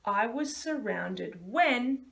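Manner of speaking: slow, stretched sentence frame; slow sentence starter. Shown as a demonstration. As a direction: stretch it out for kids to copy. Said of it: "use this sentence right here".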